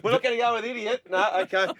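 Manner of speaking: high mock newsroom chat; lightly voiced newsroom staffer; newsroom colleague voice. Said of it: "imagine them putting the auto cue down".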